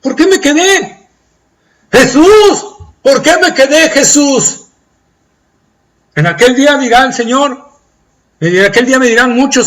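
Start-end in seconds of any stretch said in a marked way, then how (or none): 0.0-4.7 high, loud wailing plea; anguished lament. Acted. Lost soul wailing to Jesus in regret.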